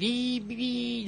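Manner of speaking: high cartoon Chihuahua squeak; squeaky Chihuahua imitation. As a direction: yip like the Looney Tunes Chihuahua. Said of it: "like the goddamn Chihuahua from the Looney Tunes".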